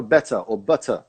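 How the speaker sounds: better and butter with a clear T; British T. Shown as a demonstration. Teacher showing the English pronunciation, "better" and "butter" with T. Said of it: "eu falo com T".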